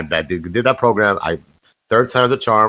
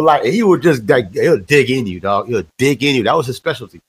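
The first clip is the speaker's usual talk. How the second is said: ordinary talk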